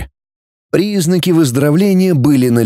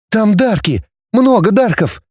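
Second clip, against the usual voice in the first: urgent, much higher voice; breathing out news; 9 semitones higher than usual. A narrator's reading. Girl brushes off adults' question with urgent warning. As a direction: blurt out the warning, pitched high. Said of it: "выдыхая".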